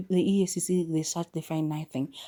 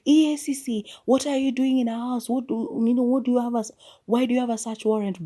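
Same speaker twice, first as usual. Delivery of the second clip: high, loud retold yell at the police; mock yell; 7 semitones higher, 5 dB louder than usual. Susie yells at the police searching the house, loud and high. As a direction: shout it up high, acting out her protest. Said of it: "she yells".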